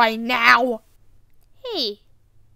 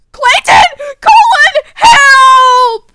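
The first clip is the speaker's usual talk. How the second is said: loud, high yell to friends; acted cry for help; 8 semitones higher, 11 dB louder, slower than usual. Calling Clayton and Colin for help, screaming. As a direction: scream for rescue, drawing out the last word.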